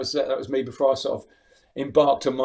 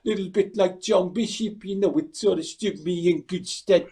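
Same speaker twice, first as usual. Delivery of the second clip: higher Scouse impression, slowing down; Scouse accent; 5 semitones higher than usual. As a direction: put on a Liverpool Scouse voice like John Bishop. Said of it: "particular teacher had a Scouse accent".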